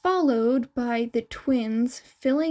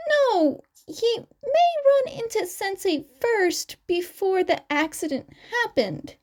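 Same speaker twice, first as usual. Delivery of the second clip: clearly higher, youthful twin character voice; 9 semitones higher than usual. Acted. Twin explaining to the interviewers, raised higher voice. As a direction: chirp the lines up high like the young twin.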